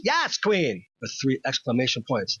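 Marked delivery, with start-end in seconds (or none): 0.0-0.8 higher, emphatic cheer at usual volume; hammed-up emphatic agreement; mock all-caps shout. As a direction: play at shouting online approval. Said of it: "I will shout in all caps".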